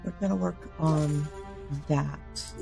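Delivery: usual speaking voice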